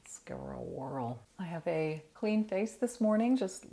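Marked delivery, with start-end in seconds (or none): 0.0-1.2 soft whisper to herself. Real, not acted. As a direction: breathe it like a little secret. Trying a new primer.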